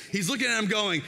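ordinary talk, nothing marked

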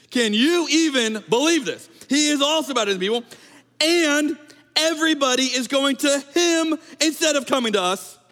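high, loud indignant whining; outraged complaint. Acted. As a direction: act the jealous disciples, outraged. Disciples complaining about Jesus.

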